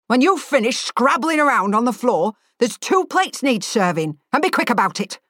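lightly voiced impatient cook; mildly voiced irritable boss; gruff chef ordering. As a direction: bark out orders as the chef, understated.

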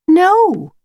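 high, loud refusal; young girl Lucy. Acted. Lucy tells the wolf she is lost, high girl's voice. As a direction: answer high and firm as a girl.

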